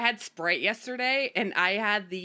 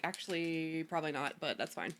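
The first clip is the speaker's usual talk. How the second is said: quiet, dry brush-off; deadpan, dropping away to nothing; 4 semitones lower, 8 dB quieter than usual. Retold or imitated. Retold dismissive reply to critics.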